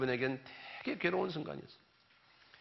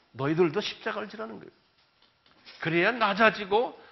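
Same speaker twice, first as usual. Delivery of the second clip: ordinary talk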